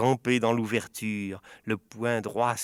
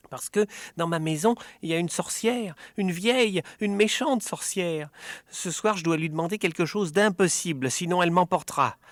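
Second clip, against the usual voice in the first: urgent worry; 7 semitones higher, 4 dB louder than usual. Acted. Urgent plea to Bachir.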